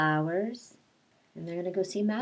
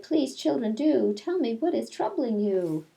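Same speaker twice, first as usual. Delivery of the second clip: higher, louder, anxious plea; caring nun; 6 semitones higher, 8 dB louder than usual. Acted. Miss Clavel pleading with the crying girls, higher and louder. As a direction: coax the children gently but urgently.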